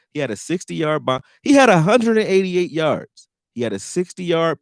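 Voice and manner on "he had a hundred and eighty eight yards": emphatic rant. Real, not acted. Ranting about opponent's stats.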